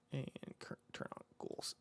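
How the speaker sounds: very soft, whispered working aloud; under-the-breath narration of a task; muttering to self. Real, not acted. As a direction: whisper each step to yourself as you do it. Muttering to self while operating software.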